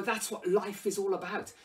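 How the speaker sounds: ordinary talk, nothing marked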